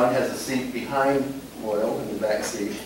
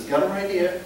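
higher-pitched retold chime-in; piping up; 7 semitones higher than usual. Partner answers from the back seat, piping up. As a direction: pipe up helpfully from behind. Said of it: "John pipes up from the back seat".

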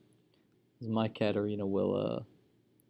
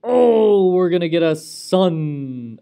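higher and louder excited cry; 7 semitones higher, 12 dB louder, slower than usual. Real, not acted. Gamer cheering as an heir is coming, excited shout. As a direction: shout the good news to the room. Heir about to be born in game.

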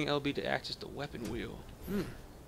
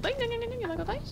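much higher mock taunt; teasing enemy in a silly high voice; squeaky taunt. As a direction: squeak "You like those dice?" way up high.

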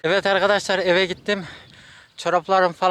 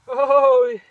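sharp yelp at icy water; cold shock yelp; 5 semitones higher, 8 dB louder than usual. Real, not acted.

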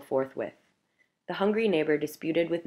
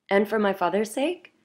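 coaxing, a bit louder and higher; persuasive; 5 semitones higher, 5 dB louder than usual. A narrator's reading. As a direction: win him over gently. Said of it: "added Thomas persuasively".

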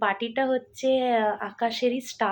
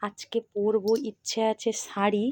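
normal talking voice

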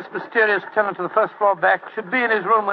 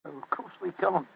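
ordinary delivery

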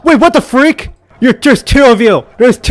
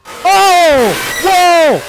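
frightened gasp; 9 semitones higher than usual. Real, not acted. Jump scare in hiding.

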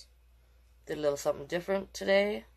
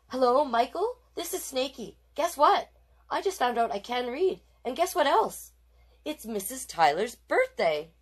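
put-on high, bubbly excited kid; excited phone call; 7 semitones higher, 3 dB louder than usual. Ronald calling his best friend Michael, excited high kid voice. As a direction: rush the news out giddily.

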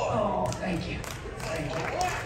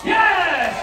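cheer; 9 semitones higher, 7 dB louder than usual. Real, not acted. Cheering a catch.

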